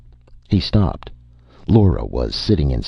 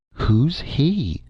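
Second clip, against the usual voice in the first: plain reading voice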